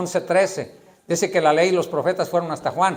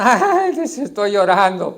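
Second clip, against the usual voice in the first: crying pet owner mocked; 3 semitones higher, 3 dB louder, slower than usual. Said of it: "pero hablan por teléfono".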